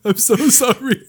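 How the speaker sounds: high, breathless laughter; laughing. Real, not acted. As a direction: laugh through the words. Laughing fit.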